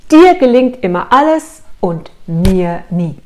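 slow, loud imitation of a husband's reproach; reproachful husband. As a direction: draw out each accusing word, hammed up. Husband's reproach repeated for audience. (gespielt vorwurfsvoll, langsam und laut)

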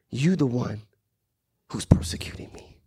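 low, hushed voice of God; God's quiet reproach. Acted. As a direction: drop to a near-whisper on the last words. Voices God addressing an enemy.

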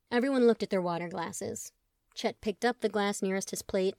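plain reading voice

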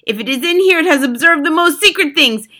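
high, loud screeched command; Grand High Witch. A narrator's reading. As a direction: order the hunt as an imperious witch. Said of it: "screeched the Grand High Witch".

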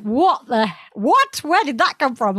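stunned, rising what; shocked surprise. Retold or imitated. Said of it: "she's like".

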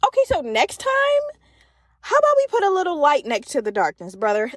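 a cheeky note for God; sassy suggestion. Pretend. Telling God what to improve next time, sassy and high. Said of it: "I'm gonna be like".

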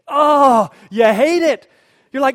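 very loud, high groaning exclamation; hammed-up loud exasperation; agonized outburst. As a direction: cry out in mock agony. Exclaims over mother's disappointment.

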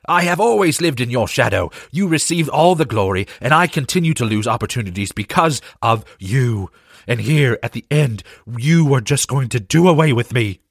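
high, resentful voice; bitter accusing. Acted. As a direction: hurl the grievance at him. Lionel's bitter accusation mid-fight.

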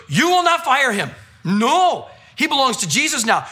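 high, emphatic imitated order; retold firm command. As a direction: lay down the law as the woman defending him. Woman orders manager not to fire.

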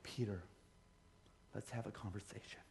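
very soft, low, near-whispered soothing; mock gentle counselor. What Jesus didn't say to Peter, mock gentle. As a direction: imitate an overly tender heart-to-heart, slowing at the end. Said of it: "he didn't go".

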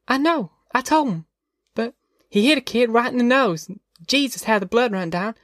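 put-on high child voice reporting a fight; small high tattletale voice; tattling little girl. A narrator's reading. Narrator voices young Ruthie.

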